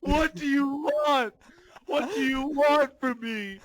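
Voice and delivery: put-on high, slow wailing shout of a bullied kid; hammed-up crying rant. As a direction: rant at the bullies through mock tears. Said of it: "goes into this rant where he's like".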